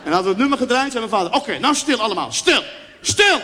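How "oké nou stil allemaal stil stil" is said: father demanding silence. Acted. Father to whole family before the call, escalating order.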